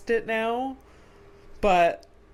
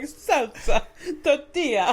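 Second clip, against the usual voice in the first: mock Spanish accent; 7 semitones higher, 6 dB louder than usual. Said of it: "I'm like".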